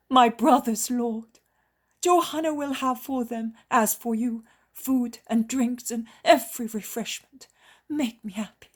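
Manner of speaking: woman pours herself out in gratitude; joyful healed woman. A narrator's reading. Healed woman offers food to disciples.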